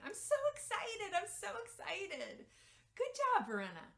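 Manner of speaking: put-on very high, gushing little-girl glee; excited toddler voice. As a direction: squeal with delight like a three-year-old. Toddler praising herself on potty.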